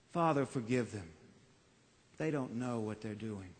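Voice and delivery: a quiet, inward echo of the prayer; hushed prayer. Shown as a demonstration. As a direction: let it fall to a hush.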